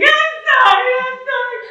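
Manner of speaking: high, loud mock wail; bawling. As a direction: imitate a girl bawling her apology. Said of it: "she's bawling and she's saying".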